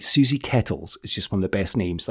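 ordinary talk, nothing marked

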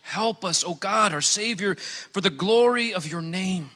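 ordinary delivery

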